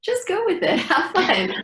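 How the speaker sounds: high, laughing cheer to just go with it; laughing encouragement. Retold or imitated. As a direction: egg them on with a laugh. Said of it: "I'm like".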